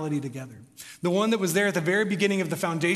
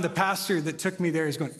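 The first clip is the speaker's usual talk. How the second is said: ordinary talk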